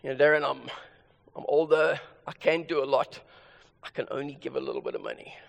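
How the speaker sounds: lightly voiced older churchgoer, low and subdued. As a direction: imitate an apologetic older member in a low voice. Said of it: "someone will say to me".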